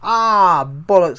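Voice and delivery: real startled cry. Real, not acted. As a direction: caught off guard by something in the eye, cry out. Something suddenly in his eye.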